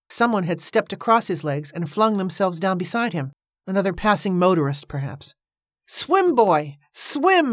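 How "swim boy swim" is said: high, urgent call; insistent shout, climbing higher. A narrator's reading. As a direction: urge the boy on with a rising cry. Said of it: "he shouted".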